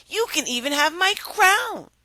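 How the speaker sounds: high bribing princess. Acted. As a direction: offer the crown, up high. Princess voiced in story.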